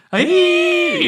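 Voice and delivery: sudden high, loud whoop; fiddler's yell. Retold or imitated. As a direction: belt out a hoedown holler. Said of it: "who pops up and starts, like, going".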